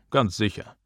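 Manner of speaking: usual speaking voice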